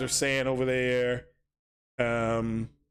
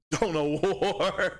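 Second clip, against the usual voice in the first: laughing; much slower than usual. Real, not acted. Laughs at own slip.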